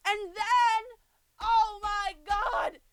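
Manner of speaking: pitched high, worked up; high-pitched dramatic exasperation; exasperated outburst. Real, not acted. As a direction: launch into an appalled rant. Exasperated rant about a show scene.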